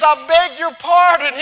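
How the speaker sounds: high, loud cowboy call; excited Texan shout. Retold or imitated. Texan rancher calling out loud to the speaker. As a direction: pop up and holler at the speaker. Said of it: "awesome Texan rich accent that I wish I could capture".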